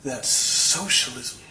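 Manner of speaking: slow, drawn-out disgust; imitating an appalled tour guide. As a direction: draw the words out in mock disgust. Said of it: "looks extremely disgusted".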